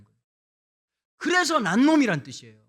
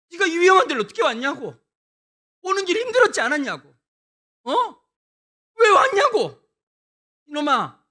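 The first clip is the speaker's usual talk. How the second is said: effusive brotherly welcome; 8 semitones higher, 8 dB louder than usual. Retold or imitated. Brothers should have welcomed him.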